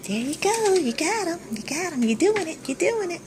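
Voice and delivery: high cheering voice. Real, not acted. Encouraging her while she cooks.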